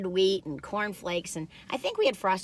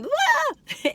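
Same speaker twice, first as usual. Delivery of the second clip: mock scare; 3 dB louder than usual. Jumping out to scare.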